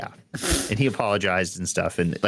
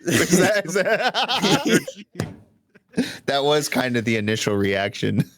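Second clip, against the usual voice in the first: laughing; 6 semitones higher, slower than usual. Real, not acted. Laughing at embarrassing reaction.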